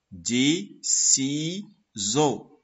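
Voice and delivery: "Des ciseaux" very slowly split as des, ci, seaux; syllable by syllable. Shown as a demonstration. Teacher ordering syllables, "Des ciseaux" very slowly broken apart. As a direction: pause after each syllable of "Des ciseaux". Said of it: "Première syllabe".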